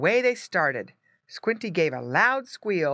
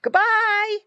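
high, loud pig squeals; squealed farewell; 7 dB louder, much slower than usual. A narrator's reading. Pig family to departing Squinty, squealing goodbye. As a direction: squeal it out like pigs in a pen. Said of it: "they all grunted and squealed".

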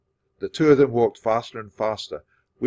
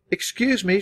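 higher, bright call for attention; 11 semitones higher, 4 dB louder than usual. A narrator's reading. Girl calling out to interrupt the teacher, raised voice. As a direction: call out to get the room's notice. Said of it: "she called out".

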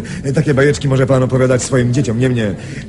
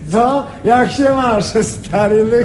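hearty greeting; 9 semitones higher, slower than usual. Acted. Man greeting an old friend on the phone, hearty. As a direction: greet your old buddy with delight.

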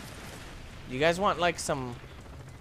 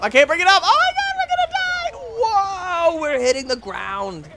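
much higher, louder panicked wail; panicked shriek; 13 semitones higher, 6 dB louder than usual. Real, not acted. Pilot losing control of helicopter shrieking in panic. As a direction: shriek as the chopper drops.

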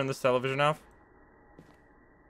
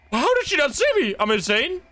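excited disbelief; 9 semitones higher than usual. Real, not acted. Escaping the demon unseen.

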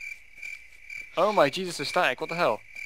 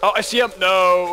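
alarmed shout; 4 semitones higher, 8 dB louder than usual. Real, not acted. Spots monster chasing him.

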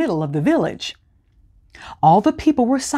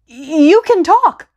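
higher, slow, stunned disbelief; stunned stammer; 10 semitones higher, 4 dB louder, slower than usual. A narrator's reading. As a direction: gasp it out in shock, slowly. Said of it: "managed to stammer out".

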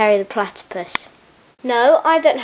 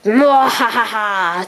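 drawn-out villain laugh, falling; slow evil cackle; much slower than usual. Acted. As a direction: cackle slowly like a cartoon villain. Villain plotting.

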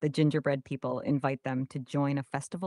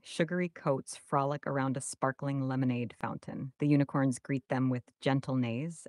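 usual speaking voice

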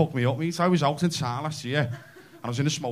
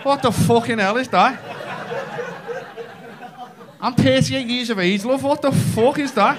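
high, slowed, laughing disbelief; mock outrage; 8 semitones higher, 3 dB louder than usual. Comedian to wife, laughing mock outrage at gift.

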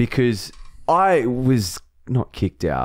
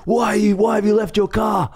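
high, raised mock officer yelling; angry officer; 11 semitones higher than usual. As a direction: scold like a cop who's not finished with you. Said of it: "He's like".

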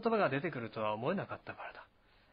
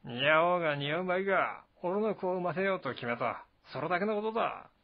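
higher, louder, brash warrior; Zhang Fei, boasting; 4 semitones higher, 3 dB louder than usual. Acted. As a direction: declare it as settled, swaggering.

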